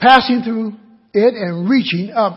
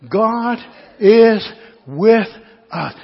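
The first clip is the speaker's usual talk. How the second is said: ordinary delivery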